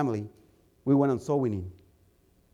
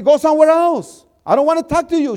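higher, louder, angry dismissal; angry lady; 10 semitones higher, 7 dB louder than usual. Retold or imitated. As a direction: send him away angrily. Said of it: "She got angry".

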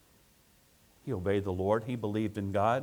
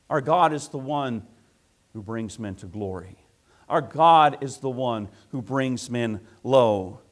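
ordinary talk, nothing marked

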